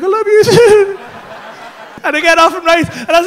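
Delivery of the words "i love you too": high, loud, drawn-out declaration; gushing reply. Retold or imitated. Teller parting from serpent, high gushing reply. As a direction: squeal it back adoringly.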